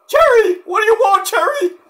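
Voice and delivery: very high, loud squeaky character; Cactus. Acted. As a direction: answer in an excited squeal. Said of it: "I wanna talk to Cactus!".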